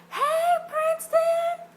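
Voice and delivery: long, high, loud call across the room. Retold or imitated. Calling across the signing line to Princeton, loud. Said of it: "I said".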